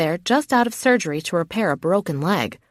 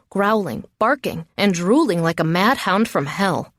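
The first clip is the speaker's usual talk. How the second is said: usual speaking voice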